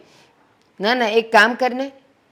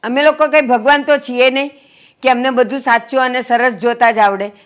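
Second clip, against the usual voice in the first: higher, insistent scolding; heated reproach; 4 semitones higher, 4 dB louder than usual. Acted. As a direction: plead their case with raised, emphatic voice. Woman rebukes angry guest in quarrel. (ઠપકાભર્યો, ભારપૂર્વકનો અવાજ)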